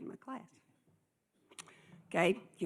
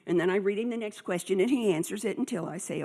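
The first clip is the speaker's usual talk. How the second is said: normal talking voice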